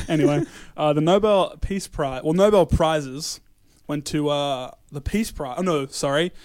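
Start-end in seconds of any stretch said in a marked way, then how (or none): none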